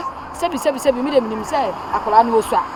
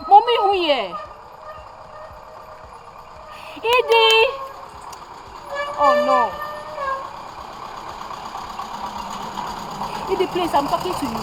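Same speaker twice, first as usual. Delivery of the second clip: distressed pleading; 9 semitones higher, slower than usual. Acted. Mother pleading with her hurt daughter, frightened. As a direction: beg her to answer.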